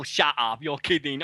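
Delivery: usual speaking voice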